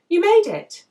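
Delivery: loud, high delighted call; tipsy friend calling out joyfully; excited greeting. Acted. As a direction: cry out in happy welcome. Josie greets arriving friend.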